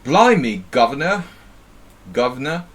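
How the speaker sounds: loud, hammed-up Cockney with swooping pitch; mock Cockney accent. Streamer teasing a friend about their British accent, hammed-up Cockney. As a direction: belt it out as a stage Cockney. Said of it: "The typical Cockney accent".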